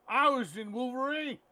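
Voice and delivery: put-on high, loud drunken boast; drunk Brian Cox. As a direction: proclaim it like a tipsy star. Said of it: "He's drunk again".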